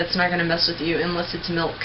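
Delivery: usual speaking voice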